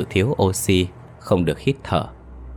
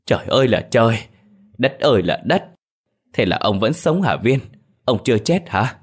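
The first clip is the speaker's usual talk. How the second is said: stunned disbelief; 4 semitones higher than usual. Acted. Old man asking his grandson if he survived, amazed. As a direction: marvel at still being alive.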